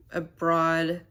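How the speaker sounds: ordinary talk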